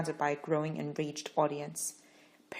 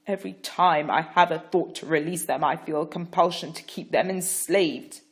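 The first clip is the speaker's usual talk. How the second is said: louder, teeth clenched; through clenched teeth; 9 dB louder than usual. A narrator's reading. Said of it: "clenching his teeth".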